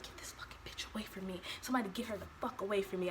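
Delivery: whispered, fed-up plea under the breath; hushed and seething, quickening at the end; irritated. Retold or imitated. Mother wanting the nurse sent away, irritated whisper. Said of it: "I'm just so irritated with this lady".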